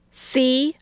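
put-on high, petulant little girl; a grumpy girl showing off; sulky child. A narrator's reading.

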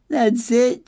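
a mild sob breaking out; sobbing. Acted. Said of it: "she sobbed".

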